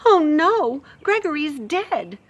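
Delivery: little mouse's shocked cry; stricken mouse. Acted. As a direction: gasp it out in horror. Mouse voice, dismay.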